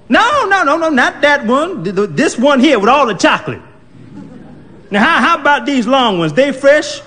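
put-on high, fussy old lady pointing; bossy old woman. Old woman picking donuts at the counter, high and fussy. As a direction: hurry and boss him around.

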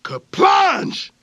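high shouted sound word; shouted splash. A narrator's reading. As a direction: call out the crash.

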